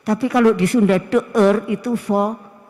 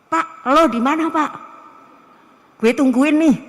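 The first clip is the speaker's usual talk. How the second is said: raised, casual mock student demand; higher, pushy student imitation; overfamiliar student; 5 semitones higher, 5 dB louder than usual. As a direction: mimic a student being too chummy with a lecturer. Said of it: "mosok manggil Pak Najahan".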